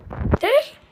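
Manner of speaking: high, loud sudden question; surprised yelp. Real, not acted. Yelping at a friend when startled. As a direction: jump up in pitch.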